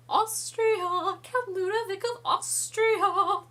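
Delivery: high, loud, slow pompous proclamation; grand mock announcement. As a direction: trumpet the title like a herald. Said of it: "It's, it's hilarious".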